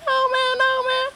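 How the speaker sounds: mock high stoner buddy; Ron's stoner voice. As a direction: do your lazy friend way up high. Ron refusing to run.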